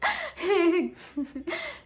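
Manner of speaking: bright amused laugh burst. Real, not acted. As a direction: laugh at yourself. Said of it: "I'm getting a little Tamil accent here".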